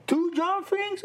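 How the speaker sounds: high, slow disbelieving echo; incredulous receptionist. Retold or imitated. Guest imitates the receptionist's astonished reply on the phone. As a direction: mimic the girl who can't believe it. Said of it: "she said".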